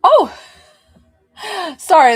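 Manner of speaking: high, loud startled yelp and apology. Real, not acted. Healer freaked out by a thing suddenly talking, real startled outburst. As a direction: jump at an unexpected voice, then apologise. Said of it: "it freaked me out".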